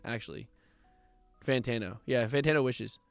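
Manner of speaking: normal talking voice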